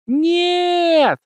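very high, loud shout. A narrator's reading. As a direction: scream No all together. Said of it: "орут в голос все дети".

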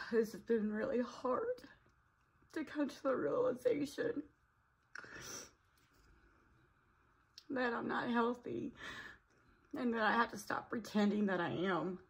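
soft, tearful and halting; crying softly. Real, not acted. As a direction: still teary, pushing the words out. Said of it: "I'm crying".

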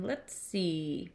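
soft musing to himself; low-key, quiet pondering; murmuring. A narrator's reading. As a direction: murmur it while thinking. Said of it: "murmured Horton".

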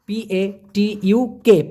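letters "পি এ টি ইউ কে" each said slowly and apart; slow spelled-out P A T U K; spelling out letters. Shown as a demonstration. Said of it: "সেটাকে বলা হবে পাতু".

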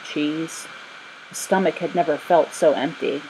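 usual speaking voice

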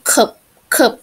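"เคิบ" with a short vowel between "เออ" and "อะ"; teacher showing how cup sounds; model pronunciation of cup. Shown as a demonstration. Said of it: "เวลาอ่านมันจะเป็น".

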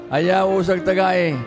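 ordinary talk

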